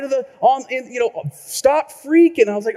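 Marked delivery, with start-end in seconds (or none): 1.3-2.5 slogan read out. Retold or imitated. Slogan on the truck.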